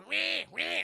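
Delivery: high-pitched mock baby wail; badly faked baby crying. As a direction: imitate a bleating infant's cry. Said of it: "and is crying".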